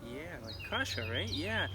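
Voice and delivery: ordinary delivery, nothing marked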